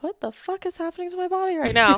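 high, alarmed mock panic at your own body; startled disbelief. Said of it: "I'm like".

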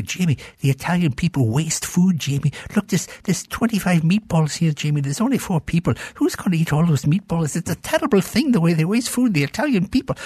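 prim, scandalized auntie; disapproving relative. Retold or imitated. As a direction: scold like a thrifty aunt. Scottish relatives scolding about waste.

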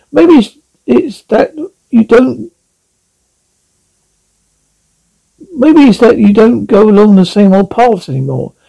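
a lightly voiced old owl calling out in eager hope; owl crying out. A narrator's reading. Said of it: "cried the owl".

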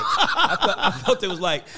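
cracking up as he tries to explain; laughing. Real, not acted. Laughs mid-sentence.

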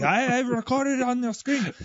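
high-pitched mock indignation; imitated controller losing it. Controller boasting he captured it, mock outraged voice. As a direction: mimic a worked-up man, pitched high. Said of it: "he's losing his stuff".

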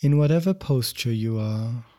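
ordinary talk

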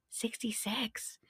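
Sixty-six whispered softly in awe; awed whisper. Shown as a demonstration. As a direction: breathe Sixty-six as a secret. Whispered repeat of the number.

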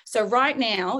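ordinary talk, nothing marked

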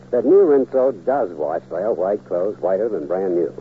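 ordinary delivery

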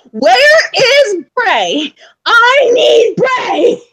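high, slow whiny yell for Bray; impatient yelling. Retold or imitated. Fan at Raw yelling for Bray, whiny high shout. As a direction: drag it out like a fan demanding her wrestler.

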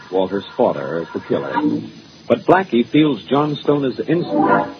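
ordinary talk

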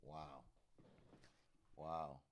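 ordinary talk

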